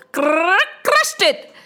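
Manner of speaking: bursting up on crushed; rising crush. A narrator's reading. Building crush refrain.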